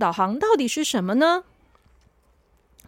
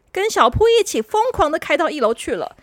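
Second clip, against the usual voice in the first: usual speaking voice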